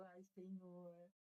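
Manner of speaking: slow, tearful "Ai, Senhor" to God; tearful sigh. Retold or imitated. Woman retelling her prayer to God, slow and tearful. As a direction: let it out slowly, moved almost to tears. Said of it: "Eu chorei".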